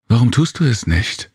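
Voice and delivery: high, daring comeback; defiant counter. A narrator's reading. Serena kontert den Kirai herausfordernd, laut und hoch. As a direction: throw the challenge back at him. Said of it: "konterte sie".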